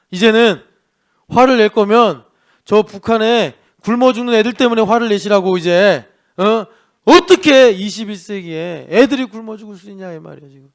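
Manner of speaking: preacher rousing anger at injustice; fiery preaching. Acted. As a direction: thunder it at the congregation. Preaching at full force.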